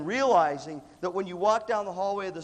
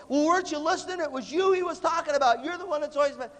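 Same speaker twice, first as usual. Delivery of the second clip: mock scolding in a much higher pitch; scolding peer; 10 semitones higher, 4 dB louder than usual. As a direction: imitate someone telling a classmate off, pitched high. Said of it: "the rest of you go".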